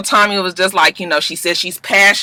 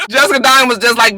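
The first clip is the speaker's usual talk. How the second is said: ordinary talk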